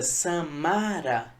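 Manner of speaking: "Samara" bem lento, com MA alongado; tônica em MA. Shown as a demonstration. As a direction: estique o MA central de "Samara". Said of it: "a sílaba tônica é ma". (segunda sílaba de "Samara" forte e prolongada)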